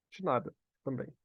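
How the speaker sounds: usual speaking voice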